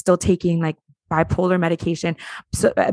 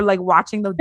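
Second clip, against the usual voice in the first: ordinary talk, nothing marked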